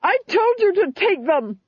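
high, loud, furious order; angry command. Acted. Duchess furious at soldiers who will not act, high and loud. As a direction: snap at the useless soldiers.

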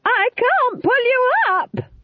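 very high, drawn-out desperate scream; piercingly high panicked yell. A narrator's reading. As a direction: scream it slowly, straining with effort. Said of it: "They stared into one another's faces and screamed".

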